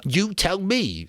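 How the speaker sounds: high, slow, anxious plea. A narrator's reading. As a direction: ask fearfully, holding back. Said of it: "said Piggy anxiously".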